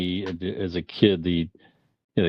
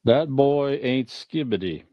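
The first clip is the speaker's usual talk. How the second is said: slow, higher mock Southern drawl; Foghorn Leghorn impression; 6 semitones higher, slower than usual. Host quotes Foghorn Leghorn's catchphrase, slow mock drawl. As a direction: drag out the line like a pompous old rooster. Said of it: "Something like that he said back then".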